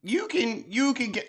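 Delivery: put-on high, loud hammy Minnesota accent; Minnesota accent attempt. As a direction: have a shaky go at a Minnesota mom accent. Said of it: "I can't do accents".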